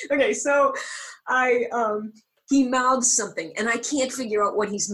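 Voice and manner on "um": normal talking voice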